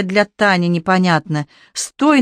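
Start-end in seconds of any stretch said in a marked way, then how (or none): 1.7-2.2 sharp shouted stop. A narrator's reading. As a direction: call out to stop him. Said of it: "на бегу крикнул Женя".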